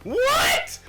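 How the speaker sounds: high, squealing disbelief, first words stretched out; astonished outcry. Retold or imitated. Viewer finding out he changed Thanos, astonished outcry. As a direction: replay your mind being blown. Retelling own shock at Thanos reveal.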